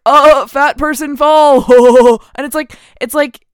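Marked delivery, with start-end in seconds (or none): 0.1-1.6 loud, high, drawn-out mock jeer; slow booming mock gag call; mocking slapstick joke. Ranting about the movie's fat jokes, mocking slapstick. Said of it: "it's just like". 1.7-2.3 loud booming fake "ho"; one big hooted mock laugh. Mocking the movie's fat person fall joke, loud fake laugh.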